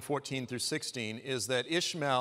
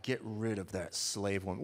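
low mock anger; 5 semitones lower, 4 dB quieter than usual. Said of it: "Sarah is mad".

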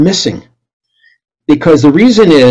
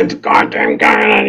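angry mutter; 6 semitones higher, 5 dB quieter, much slower than usual. Retold or imitated. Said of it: "I was so mad".

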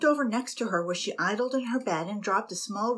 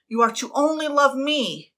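louder, higher demanding tone; jealous captain ordering his fiancée; possessive demand; 6 semitones higher, 7 dB louder than usual. A narrator's reading. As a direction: command her devotion.

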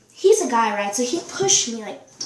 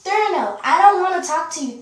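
dismissive; 4 semitones higher, 6 dB louder, faster than usual. Real, not acted. Brushing off someone during the recording.

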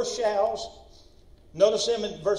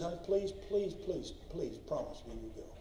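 soft, hushed pleading as the wife; retold begging; 3 semitones lower, 11 dB quieter than usual. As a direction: beg quietly, trailing lower and softer. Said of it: "begged him, please".

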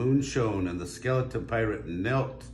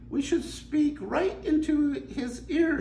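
high, slow mermaid voice; a bright idea, drawn out; slower than usual. Acted. Mermaid suggesting the whale's ear, high and slow.

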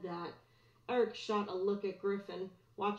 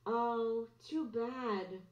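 fake sympathy; 3 semitones higher, much slower than usual. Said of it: "putting on a sympathetic face".

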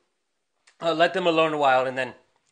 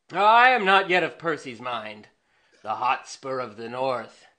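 usual speaking voice

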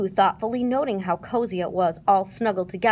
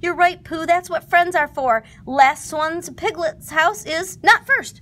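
Tigger shouting; 7 semitones higher, 5 dB louder than usual. A narrator's reading. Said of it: "shouted Tigger".

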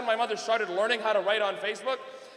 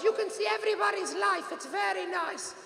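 imitated mother; 8 semitones higher, 3 dB quieter than usual. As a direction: play Mom in a high put-on voice. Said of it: "She goes".

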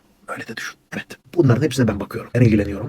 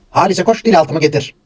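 put-on a panicked old man calls out, pitched up and loud; mock heart attack cry; 7 semitones higher, 7 dB louder than usual. Old man in a fake heart attack calls to his wife, panicked and loud. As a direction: yell for the heart pills, hammed-up.

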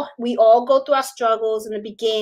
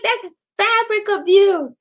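mock outrage; 8 semitones higher, slower than usual. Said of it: "I was like".

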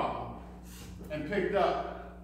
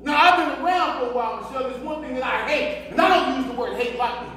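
raised, heated voice; angry confrontation; 4 semitones higher, 6 dB louder than usual. Acted. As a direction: lay down the law to a lying wife. Husband confronts lying wife.

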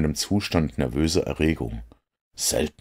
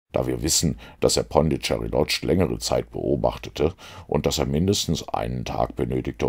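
ordinary delivery, nothing marked

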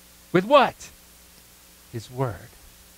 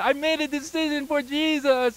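excited news; 10 semitones higher than usual. Retold or imitated. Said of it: "calling Walter and said".

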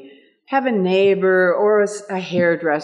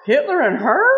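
surprised; 7 semitones higher, 3 dB louder than usual. Retold or imitated. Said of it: "they're looking like".

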